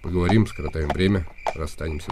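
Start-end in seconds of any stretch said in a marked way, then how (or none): none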